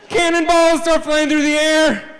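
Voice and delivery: high, drawn-out storyteller's yell; excited battle shout. Acted. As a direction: shout it out like a pirate attack breaking out. Pirate attack acted out in story.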